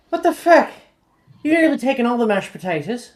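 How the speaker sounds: shrill, fast outraged yell; angry yell. Acted. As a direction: scream it across the table. Angry girl at the table.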